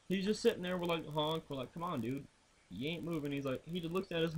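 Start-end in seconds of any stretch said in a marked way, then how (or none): 1.7-3.3 retold muttering at a bull blocking the road; muttered plea. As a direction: mutter at the bull under your breath. Talking to bull quietly.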